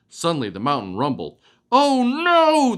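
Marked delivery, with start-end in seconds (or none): 1.7-2.8 high alarmed cry of oh no; a dog yelping in panic; howled. Acted. Dog howls as the mountain rumbles, alarmed. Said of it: "the dog howled".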